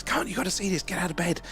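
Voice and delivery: high, urgent hushed calling; waking your wife to see a UFO; excited. Retold or imitated. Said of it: "called the missus".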